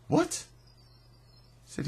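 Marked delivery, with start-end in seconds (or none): 0.1-0.4 sudden high-pitched retold what; shocked what. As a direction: jump way up in disbelief. Hearing shocking news from friend.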